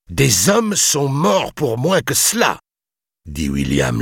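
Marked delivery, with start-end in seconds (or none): 0.1-2.6 high, slow, menacing warning; angry threat. A narrator's reading. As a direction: warn him in slow anger. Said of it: "les joues rouges de colère". (menace lente, pleine de colère)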